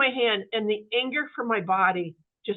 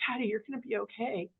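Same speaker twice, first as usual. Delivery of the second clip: softer, hushed retelling of a whisper; retold whisper; 2 semitones higher, 9 dB quieter, faster than usual. Daughter to her dying dad, hushed and tender. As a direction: ease the voice right down as if at his ear. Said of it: "I just whispered in his ear".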